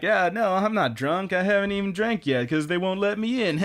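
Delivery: hammed-up drunk excuses; drunk brother. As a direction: play a tipsy man protesting. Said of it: "Luculia's drunk brother".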